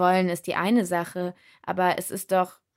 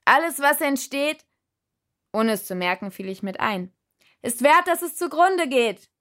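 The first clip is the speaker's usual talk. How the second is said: much higher, louder shout together; shouting along; 6 semitones higher, 3 dB louder than usual. A narrator's reading. Shouting the quote along with the spirit, roaring. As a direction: roar the line along with him. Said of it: "Mitbrüllen".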